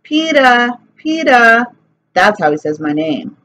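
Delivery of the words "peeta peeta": put-on higher, louder little-kid call; toddler calling. A narrator's reading. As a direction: call out from the jungle gym. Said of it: "he called".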